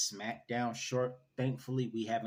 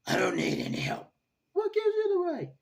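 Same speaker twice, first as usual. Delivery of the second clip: raspy, higher, louder vigilante outburst; Batman; 5 dB louder, faster than usual. Acted. Host doing his best Batman impression, straining high and raspy. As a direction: strain out a hoarse, high-pitched angry growl. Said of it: "do my best Batman impression".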